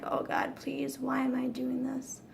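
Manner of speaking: soft, breathy, scared plea to God; frightened prayer. Retold or imitated. Walking toward the ice, whispered scared prayer. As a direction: pray under your breath, dreading it. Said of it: "and I'm like".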